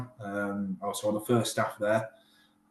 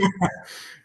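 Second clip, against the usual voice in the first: laugh; 10 semitones higher than usual. Real, not acted. Laughing at the teeth compliment.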